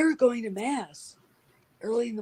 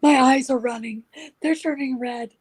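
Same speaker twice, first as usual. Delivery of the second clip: higher, louder, overdramatic complaint; dramatic panic; 6 semitones higher, 4 dB louder than usual. Retold or imitated. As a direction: wail it like you're dying. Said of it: "And I'm like".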